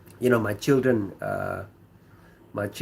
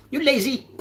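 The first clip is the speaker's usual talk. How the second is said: high mocking accusation; 12 semitones higher than usual. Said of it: "people might say that".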